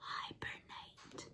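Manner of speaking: hushed whispered aside; quiet secret voice. Real, not acted. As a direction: whisper the answer softly.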